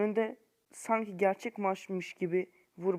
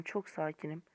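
mock calm; 3 semitones lower, 10 dB quieter than usual. Real, not acted. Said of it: "Ses tonumdan da anlaşılacağı gibi sakinim".

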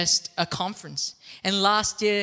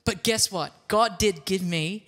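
ordinary delivery

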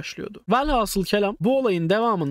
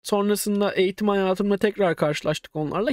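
normal talking voice

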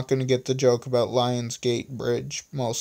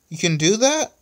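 high, louder excited question; 8 semitones higher, 5 dB louder than usual. A narrator's reading. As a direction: ask in eager amazement. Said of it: "so excited that she ignored the fact".

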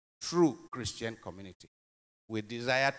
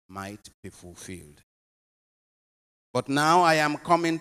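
solemn Gospel reading; 7 dB louder than usual. Acted. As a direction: proclaim it from the pulpit.

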